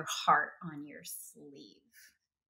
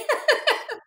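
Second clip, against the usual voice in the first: high giggle; 6 dB louder than usual. Real, not acted. Laughing at the nickname.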